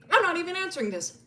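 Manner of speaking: high-pitched, dismissive brush-off; indignant refusal. Acted. She refuses the prison call.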